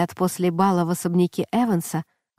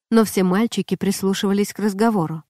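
plain reading voice

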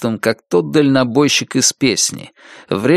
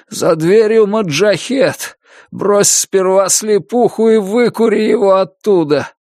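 battle shout; 9 semitones higher than usual. A narrator's reading. Dying Billy reliving combat, shouting orders at his comrade. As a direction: yell an order under fire. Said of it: "кричит он".

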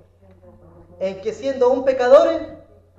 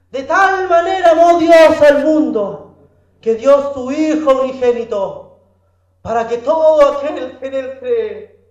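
loud, fervent scripture proclamation; full-force preaching; 2 semitones higher, 8 dB louder than usual. Real, not acted. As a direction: proclaim it over the whole congregation. Predica a plena fuerza. (predicación ferviente a toda voz)

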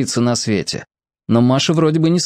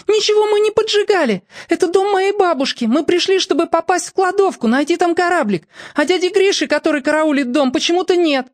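near tears. A narrator's reading. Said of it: "всхлипнула".

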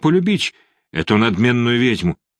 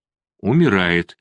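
медленно, ровно, без эмоций; произнеси «умирает» пусто и медленно; без выражения; 3 semitones lower, slower than usual. Acted. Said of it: "без всякого выражения сказала она". (оцепенело, безжизненным голосом)

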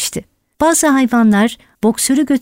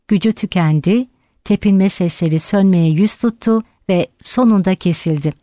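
plain reading voice

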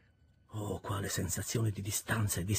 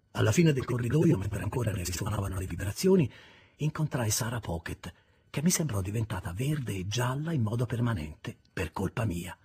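usual speaking voice

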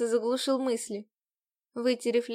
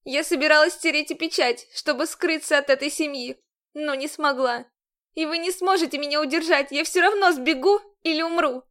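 defiant heroine; 4 semitones higher, 5 dB louder than usual. Acted.

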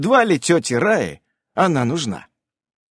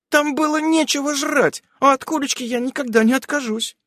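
put-on teen daughter; 14 semitones higher than usual. A narrator's reading. Daughter voiced in story.

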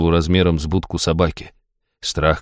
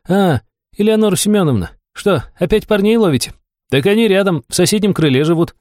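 higher, mocking provocation; cheeky; 11 semitones higher than usual. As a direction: tease the warden openly. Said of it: "перебрала с наездом".

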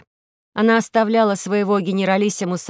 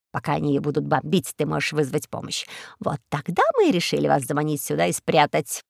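low, confiding villain voice; scheming eagle; 4 semitones lower, 3 dB quieter than usual. Acted. Eagle reveals the pirate plot to Карло, low and smug. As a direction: lay out the plot in low tones.

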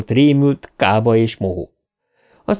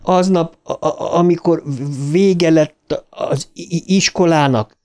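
stammering; 7 semitones higher than usual. A narrator's reading. Bill keeps stammering to Ben.

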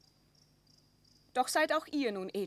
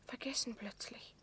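breathless whisper of shock; the words have fled her, whisper it; softer, barely voiced dismay; stunned whisper; 2 semitones higher, 4 dB quieter than usual. Maria stunned before the queen.

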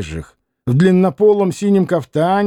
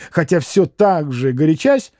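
usual speaking voice

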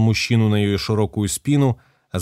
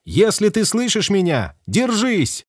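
high, strained shout, slowing at the end; shouting over wind; 12 semitones higher, slower than usual. Acted. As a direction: call out over the rushing wind. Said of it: "перекрикивая ветер".

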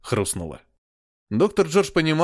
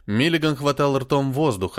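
ordinary delivery, nothing marked

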